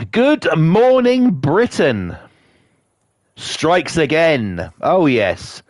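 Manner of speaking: slow, drawn-out mock TV announcement; mock announcer. Announcing another thing that annoyed him today, mock announcer. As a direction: stretch it out like a show title.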